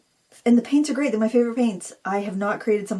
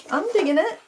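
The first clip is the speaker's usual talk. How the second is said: high sing-song; 10 semitones higher than usual. Pretend. Repeats phrase two ways.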